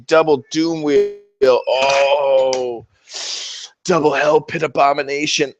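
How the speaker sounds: imitating a gushing Skaven fan, swooning over rats; hammed-up fanboy gushing, rising to a squeal; mock excited Skaven player. Mocking Skaven players: gushing fanboy voice. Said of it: "Are you like".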